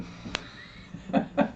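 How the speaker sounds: breathy fond chuckle; laugh. Real, not acted. Remembering his friend was a drummer too, soft chuckle. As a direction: chuckle softly at the memory.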